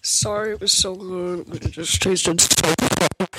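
slurping a drink while talking. Real, not acted. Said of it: "Can you stop slurping".

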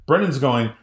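ordinary talk

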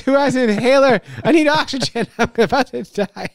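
high, breathless mock panic; gasping for air. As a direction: laugh through a hammed-up gasp. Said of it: "I'm in pain and I'm winded".

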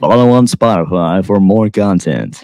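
slow, smooth ad read; announcer plugging the show; radio voice. Pretend. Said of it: "talk like this into the microphone".

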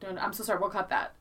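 normal talking voice

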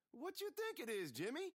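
high, mocking boy's voice; Maynard asking. A narrator's reading. Maynard teasing Jimmy, a high mocking question. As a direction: needle him with a high voice. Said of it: "fat little Maynard asked him".

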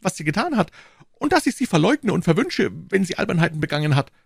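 very high-pitched, agitated character voice; frantic pleading grocer. Acted. Accused grocer swearing innocence to the commissioner, frantic and high. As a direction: protest your innocence in a high, panicky voice.

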